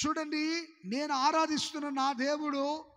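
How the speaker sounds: ordinary talk, nothing marked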